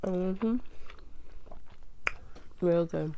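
muffled closed-mouth murmur; chewing while talking. Real, not acted. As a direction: mouth partly full. Seen 1: Bites shrimp before; mouth closed, chewing while murmuring "mm-hmm.

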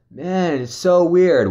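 high-pitched puzzled friend; imitated puzzlement. Friend to his companion after long search, imitated puzzlement.

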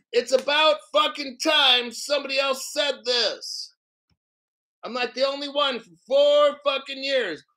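high, heated outburst; angry rant. Real, not acted. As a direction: vent it, you've said this for years. Ranting against crypto judges.

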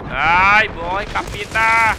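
loud, slow drawn-out cry at the enemy; frustrated yell. Real, not acted. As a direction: blurt it out loud and stretched. Enemy kills him, yells.

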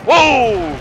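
loud startled whoa; surprised whoa. Real, not acted. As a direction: jolt at the hit. Surprised by a hit.

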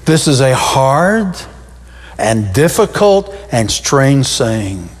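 slow, weary grumble of doubting disciples; slow mock complaint. Preacher voicing disciples to church, slow grumble. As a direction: drag it out like a disbelieving grumble. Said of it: "look what they said".

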